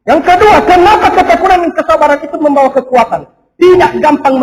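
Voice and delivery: loud, high, driving sermon voice; fervent preaching. Acted. Pastor urging the congregation, loud fervent preaching. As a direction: preach it out to the whole church.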